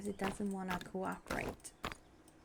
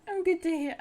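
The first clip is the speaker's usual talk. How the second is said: tongue-slip; 9 semitones higher, 8 dB louder than usual. Real, not acted. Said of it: "I can't speak".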